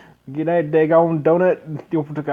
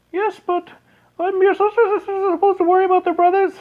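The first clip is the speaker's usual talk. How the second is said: put-on very high, louder falsetto girl; sister's voice; 4 dB louder than usual. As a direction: squeak it as the little sister. Sister retorts to brother.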